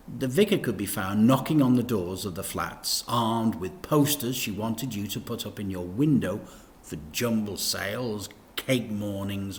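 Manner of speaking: ordinary delivery, nothing marked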